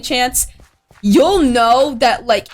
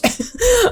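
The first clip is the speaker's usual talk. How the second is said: high giggle; 11 semitones higher than usual. Real, not acted. Laughing at own dream fear.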